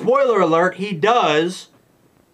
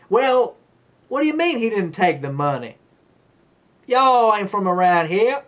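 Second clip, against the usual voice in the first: mock Southern accent; 4 semitones higher than usual. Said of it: "her accent came and went".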